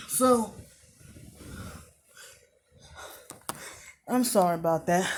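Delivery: breathless after rushing back; panting slightly while apologizing; out of breath. Real, not acted. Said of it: "I'm out of breath".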